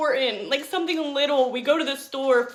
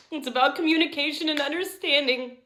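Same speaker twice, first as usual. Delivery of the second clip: ordinary talk, nothing marked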